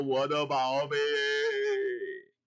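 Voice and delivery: low, very slow drawn-out lament; mock wailing. Guangchengzi mourns his seal, low slow hammed-up wail. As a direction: moan it like a mourner. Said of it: "这俩都哭了".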